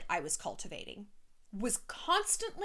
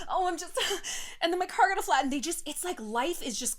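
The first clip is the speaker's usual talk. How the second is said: higher, louder whiny complaint; mock whiny friend; 7 semitones higher, 4 dB louder than usual. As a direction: play the dramatic neurotic friend. Mocking neurotic friend.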